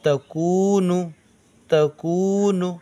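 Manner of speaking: तकूनू slowly with कू drawn out; slow recitation drill; slow blended reading. Shown as a demonstration. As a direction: stretch the कू in तकूनू. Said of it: "काफ वा पेश कू".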